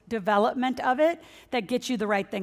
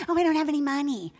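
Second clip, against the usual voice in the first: higher, mocking someone who says they're broke; mock whiny excuse; 5 semitones higher than usual. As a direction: imitate a lazy complainer, lightly mocking. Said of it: "You should never walk around and say".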